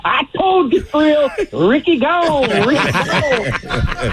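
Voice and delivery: high, slow, gloating Ricky Henderson; hammed-up high Ricky taunt. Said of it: "He says".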